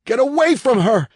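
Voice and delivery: high, loud yell of protest; frantic shout. Acted. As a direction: throw the voice at her in panic. Husband shouting to save wife.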